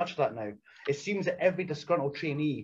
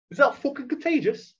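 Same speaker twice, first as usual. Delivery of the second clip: high-pitched mock incredulous question; imitated alarm; 10 semitones higher, 3 dB louder than usual. Opponent worried about conjunctivitis, imitated alarm.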